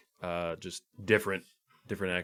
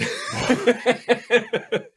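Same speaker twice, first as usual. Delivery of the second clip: loud high laugh; 7 dB louder than usual. Real, not acted. Laughing at crude joke.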